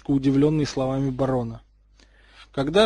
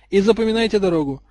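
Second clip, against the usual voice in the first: raised, lightly commanding call; prefect's instruction; 5 semitones higher, 4 dB louder than usual. A narrator's reading. Prefect tells first-years to mind the way, calling out. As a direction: call it out to the group.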